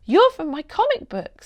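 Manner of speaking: put-on high, hesitant little girl's voice; young girl stammering in surprise. A narrator's reading. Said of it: "stammered Seb".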